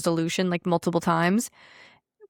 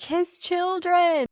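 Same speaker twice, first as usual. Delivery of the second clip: high, slow, shocked wail; mock outrage; 11 semitones higher, 3 dB louder, much slower than usual. Mocking critics gasping over the kids. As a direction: ham up a pearl-clutching cry. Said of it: "You guys would've been like".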